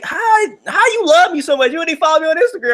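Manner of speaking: high-pitched, rushed disbelief; incredulous protest. Retold or imitated. Confronting her for not following back, incredulous protest. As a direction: voice shooting up in mock outrage.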